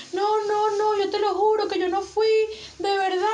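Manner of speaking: high-pitched mock begging; imitated desperate pleading. Retelling a woman begging her captors in a video, imitated pleading. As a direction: plead innocence as the victim did.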